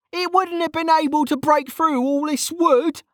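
put-on high-pitched insistent young mage; stubborn protest. Mage disputing the officer's scolding, stubborn protest. Kettle stubbornly argues back.

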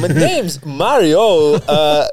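slow, loud, oddly spaced Walken drawl; Christopher Walken as Mario. Acted. Comic answering the Walken question, slow loud Mario. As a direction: lean on each word in a Walken cadence. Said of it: "Is that Christopher Walken?".

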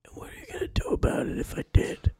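fast, hushed, menacing whisper; threatening whisper. Acted. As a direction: hiss the challenge quietly. Cashier threatens customer.

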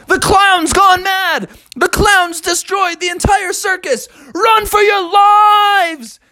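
high, hammed-up crowd panic; panicked screaming. Crowd flees the collapsing tent, mock panic screams. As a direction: cry out high, stretching the words. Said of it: "all the people were screaming".

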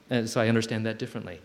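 normal talking voice

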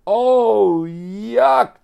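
high, loud hammed-up disgust; disgusted cry. Joey feels it squish through his toes, loud disgust. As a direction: wail it out, grossed out. Joey steps in goose droppings.

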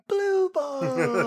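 high, drawn-out actor's exercise cry; hammed-up emotion on a warm-up catchphrase; mock drama-student overacting; overemoting. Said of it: "have an emotion when you catch it".

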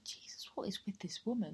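unvoiced muttered remark; whispered aside. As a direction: whisper it under your breath, baffled. Teacher mutters about the narrator.

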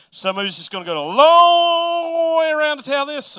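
exaggerated drag on "long" to sound like a huge detour; loud comic stretch of the word "long". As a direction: hold "long" out, high and loud. Stretches long to act stalling.

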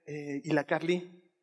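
drawn-out worried question; a hint of an anxious mother; lightly voiced mother. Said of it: "mi mamá me dice".

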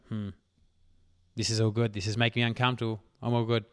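normal talking voice

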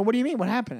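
mild imitated shock at being dropped; retold disbelief. Retold argument with cabbie.

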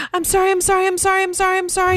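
put-on high, loud kid rattling off apologies; frantic mock apology. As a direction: imitate a child begging out of trouble. Mimics kid's panicked, insincere apology.

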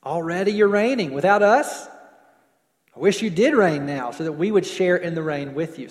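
loud, mildly sarcastic apostle. Retold or imitated. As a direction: voice Paul's sarcasm, leaning hard on ALREADY. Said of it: "give some apostolic sarcasm to him".

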